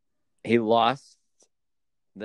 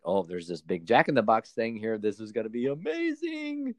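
giddy Alexa Bliss. Retold or imitated. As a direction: gush like a thrilled young woman. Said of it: "and like".

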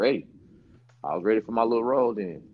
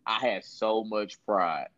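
ordinary talk

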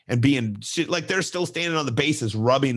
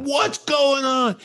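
much higher, slow, mock outraged demand; angry parents; 3 dB louder, much slower than usual. Angry parents yell at the coach, high mock outrage. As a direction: imitate the upset parents, stretched out. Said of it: "now the parents are pissed".

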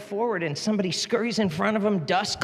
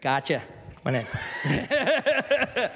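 teasing laugh; 3 semitones higher, much slower than usual. Retold or imitated. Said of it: "he's gonna say".

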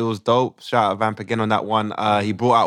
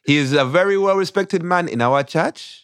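put-on Ugandan accent; 7 semitones higher than usual. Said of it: "we do it in a Ugandan accent".

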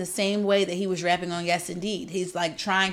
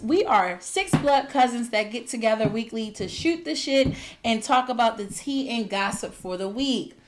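ordinary talk, nothing marked

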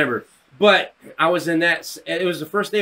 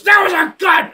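outraged, high-pitched bellow; imitated yell; 7 dB louder than usual. Teacher accusing kid over toy gun, yelling. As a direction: accuse at full volume, like an angry teacher. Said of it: "He yelled something".

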